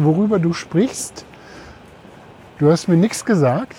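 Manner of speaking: normal talking voice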